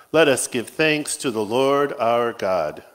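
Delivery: usual speaking voice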